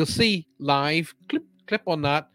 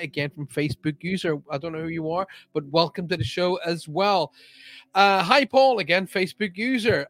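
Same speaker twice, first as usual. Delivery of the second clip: ordinary talk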